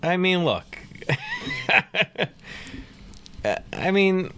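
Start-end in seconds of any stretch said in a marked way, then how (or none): 1.0-2.3 high laugh. Real, not acted. Laughs before ranking the beer.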